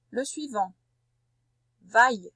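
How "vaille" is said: "Vaille" called out louder; dictation voice. Shown as a demonstration. Teacher dictating "Vaille" to a pupil, loud and clear. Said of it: "dictating syllables to pupil".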